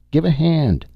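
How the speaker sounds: high-pitched call; calling for help. A narrator's reading. Calls up for help from below.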